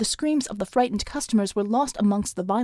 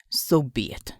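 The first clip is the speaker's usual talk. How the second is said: low cold villain; 8 semitones lower, 3 dB quieter, slower than usual. A narrator's reading. Villain accepts.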